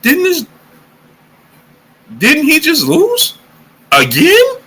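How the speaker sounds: high, slow incredulous question; disbelief. Real, not acted. Streamer to chat about Chisora's losses, high and slow. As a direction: stretch out the disbelief. Baffled by another fight.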